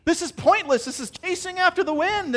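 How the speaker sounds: high, loud, fed-up sigh of a king; weary Solomon lament. Retold or imitated. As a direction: groan it out as a disillusioned old king. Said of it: "and he's like".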